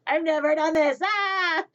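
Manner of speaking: loud, high panicked yell; mock freak-out. Babysitter new to this, mock freaking out loudly. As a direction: let the held-in panic burst out, hammed up. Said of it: "freak out or anything".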